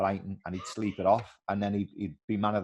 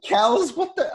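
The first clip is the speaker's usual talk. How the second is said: high, loud exasperated shout; shouting across the training pitch at a teammate; outraged yell; 8 dB louder, slower than usual. Retold or imitated. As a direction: call him out, disbelieving. Retold catching teammate in his boots.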